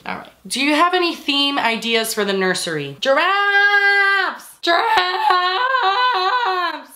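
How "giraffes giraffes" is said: excited drawn-out cry. Pretend. Answering what the nursery theme is, excited drawn-out cry. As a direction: stretch the word way out, high.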